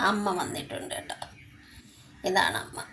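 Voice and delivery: softly whispered to the kittens. Real, not acted. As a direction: hush it like a secret to little ones. Whispering to kittens about mother. (കുഞ്ഞുങ്ങളോട് മന്ത്രിച്ച്)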